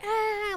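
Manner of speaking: high, soft imitation child's wail; mock crying wail. Imitating the niece crying at the table, mock wail. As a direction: imitate a little kid's drawn cry. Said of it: "like, crying".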